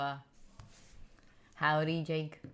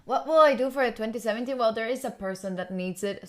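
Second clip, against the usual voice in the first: ordinary delivery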